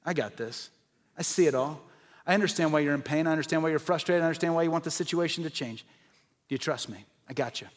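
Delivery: hushed, tender voice of comfort; reassuring God voice. Retold or imitated. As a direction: comfort them gently, as God. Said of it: "he's like".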